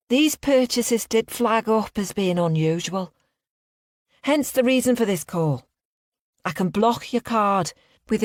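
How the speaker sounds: high, put-on Liverpudlian accent. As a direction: fake a Liverpool bank caller. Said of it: "I put on a strong Liverpudlian accent".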